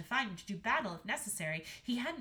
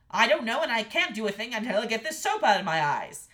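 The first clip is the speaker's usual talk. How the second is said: loud, exasperated complaint; exasperated king; 12 dB louder than usual. A narrator's reading. King with soap in his eyes, exasperated and loud. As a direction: snap it back loudly at your wife.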